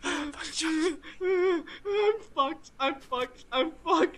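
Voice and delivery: high, half-crying panic; scared horror game streamer. Real, not acted. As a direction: whimper in frightened despair. Lost and chased.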